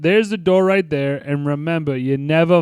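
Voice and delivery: put-on movie tough guy's Boston accent; hammed-up Boston accent, dropping the R's; thick Boston accent. Guy who tips him off, thick Boston accent. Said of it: "Boston accent".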